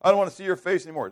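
father banishing his son, shouting; angry father. Retold or imitated. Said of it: "And his father says".

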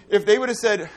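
normal talking voice

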